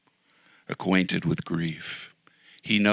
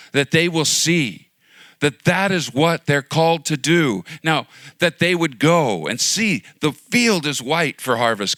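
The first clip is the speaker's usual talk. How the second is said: loud, higher, pleading preaching; impassioned preaching; 6 semitones higher, 5 dB louder than usual. Acted. Pastor urging the church toward the harvest, loud preaching. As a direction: plead with the crowd to go out.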